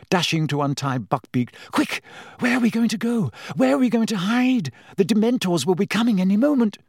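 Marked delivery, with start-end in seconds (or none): 1.7-6.8 put-on much higher, fast frightened girl's voice; panicked Hermione. A narrator's reading. Hermione panicking as the werewolf comes. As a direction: rush the questions in panic.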